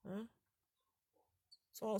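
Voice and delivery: ordinary delivery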